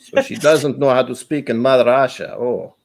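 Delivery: mock Russian accent, heavy and deliberate; hammed-up Russian accent, measured mock threat; bad Russian accent. Mocking the friend's bad accents, fake Russian accent. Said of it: "her Irish and Russian accents are really bad".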